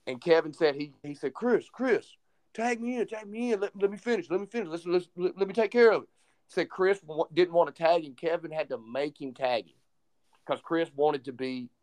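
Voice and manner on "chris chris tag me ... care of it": higher, urgent plea to be tagged in; urgent pleading. Retold or imitated. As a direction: beg him to let you take over. Kevin begging Chris mid-match.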